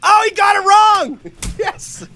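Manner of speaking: high, loud triumphant cry; gleeful shout. Real, not acted. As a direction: crow over their mistake. Gloating at a wrong guess.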